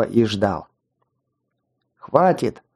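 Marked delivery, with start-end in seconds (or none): 2.0-2.6 выкрик. A narrator's reading. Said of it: "выкрикнул я".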